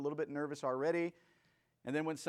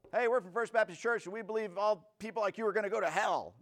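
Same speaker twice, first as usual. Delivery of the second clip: high, loud holier-than-thou proclamation; mock overbearing condemnation; 8 semitones higher, 5 dB louder than usual. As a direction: announce damnation on the doorstep, hammed-up. Mock overbearing door-knocker condemning.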